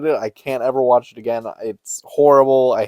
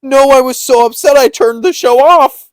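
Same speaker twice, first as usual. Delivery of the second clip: loud laughing retort; 11 semitones higher, 8 dB louder than usual. Retold or imitated. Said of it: "I'm like".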